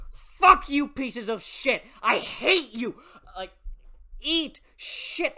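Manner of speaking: loud, high furious yell; screaming at the book. Retold or imitated. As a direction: scream every curse at the villains. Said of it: "I paused the audiobook so I could scream and shout".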